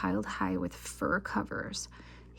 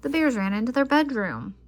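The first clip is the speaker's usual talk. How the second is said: plain reading voice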